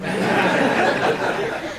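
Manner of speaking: a breathy laugh that rises; amused laugh. Real, not acted. Officer laughs at his first-day backwards vest, rising laugh. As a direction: laugh at your own rookie blunder.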